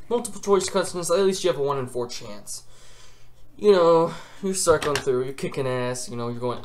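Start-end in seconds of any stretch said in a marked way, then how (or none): none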